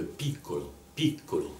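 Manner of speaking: PIccolo, first syllable stressed, Italian style. Shown as a demonstration. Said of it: "dans piccolo, j'ai bien piccolo".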